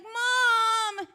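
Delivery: high mock child shout; a child's loud call for mom; kid yelling Mom. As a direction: yell it like a kid calling from outside. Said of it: "he's like".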